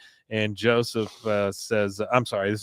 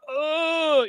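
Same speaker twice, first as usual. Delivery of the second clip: mock yawn. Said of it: "tries to do the".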